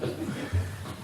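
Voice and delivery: soft, breath-only chuckle; quiet laugh. Real, not acted. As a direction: laugh lightly under the breath. Laughs about the last question.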